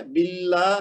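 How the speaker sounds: "باللہ" as bil-laa, then a separate hi; correct ending demo. Shown as a demonstration. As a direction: model باللہ slowly with an ee ending, not hay. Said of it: "ہے نہیں کہنا".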